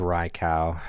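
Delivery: Raikou said as RYE-kow; alternative pronunciation. Shown as a demonstration. Host trying out how to say the name, Raikou as RYE-kow. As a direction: end Raikou on "kow", like cow. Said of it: "how do you pronounce Raikou".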